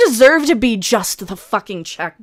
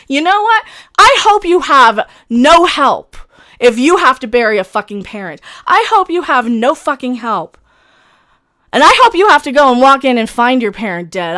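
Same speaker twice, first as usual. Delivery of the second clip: loud, bitter outburst with hard stresses; furious cursing; 5 dB louder than usual. Real, not acted. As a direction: lash out at the trolls who hurt you. Bereaved streamer curses trolls.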